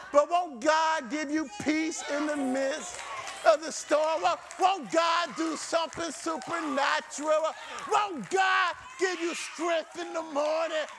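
rousing call to the congregation; urgent, ringing sermon questions; impassioned preaching. Real, not acted. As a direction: drive each question home like a revival preacher. Preaches rising rhetorical questions.

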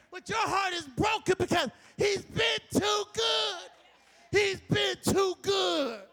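preaching at full force. Acted. Pastor urging the church not to depend on people, preaching at full force. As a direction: drive it home to the congregation, full voice.

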